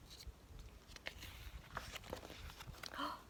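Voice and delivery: long breathy gasp of suspense; storyteller gasping before the reveal; soft whispered gasp. Acted. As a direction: gasp as if something is about to appear. Reader builds suspense.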